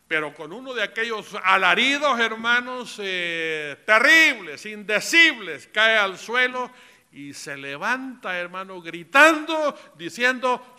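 high, loud sermon storytelling; impassioned preaching. A narrator's reading. Preacher telling the church of a man falling, impassioned preaching. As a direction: preach it loudly, voice lifted, drawing out key words. Preacher builds to testimony climax.

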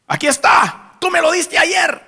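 imitating a young man's high, loud shout; excited shout. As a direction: shout it out in triumph, high and stretched. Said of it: "Y me da un grito". (grito agudo y emocionado, imitado)

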